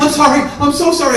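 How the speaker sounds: high, loud, frantic voice; distraught apology. Retold or imitated. Wife apologising to fallen Michael, high and distraught. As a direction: imitate a wife pleading sorry.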